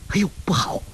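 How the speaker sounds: high, airy gasp of alarm; sudden "oh no" to herself; alarmed exclamation. A narrator's reading. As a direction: blurt it out high, startled. Sudden alarm at danger in fight.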